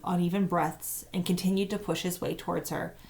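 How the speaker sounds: ordinary delivery, nothing marked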